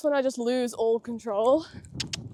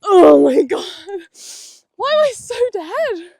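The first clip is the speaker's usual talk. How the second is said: loud, high, winded exclamation; breathless; 4 semitones higher, 7 dB louder than usual. Real, not acted. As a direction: gasp it out, spent from riding. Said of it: "I actually cannot breathe".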